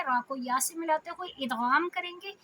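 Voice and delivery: usual speaking voice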